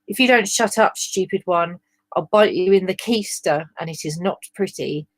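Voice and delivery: plain reading voice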